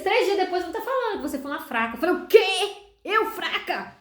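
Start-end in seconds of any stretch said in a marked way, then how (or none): 2.2-4.0 higher, acted indignant retort; indignant disbelief. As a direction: throw it back as an affronted question. Acted indignant reply to God. (indignação encenada, sem acreditar)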